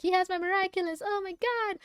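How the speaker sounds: high-pitched imitating of a panicking heroine; mock panic. Retelling Ladybug's panic attack, high mock panic. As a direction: squeak it out like she's losing it. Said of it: "She's freaking out".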